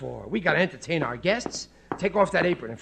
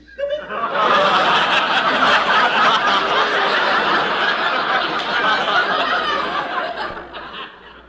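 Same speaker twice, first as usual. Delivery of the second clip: embarrassed laugh; 7 semitones higher, 4 dB louder than usual. Acted. Embarrassed after reading racy passage.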